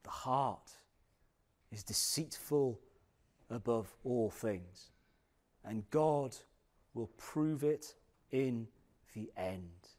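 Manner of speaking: low, slow reading with heavy pauses; solemn scripture reading. Acted. Preacher reading Jeremiah to the congregation, low and solemn. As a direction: read it gravely, phrase by phrase.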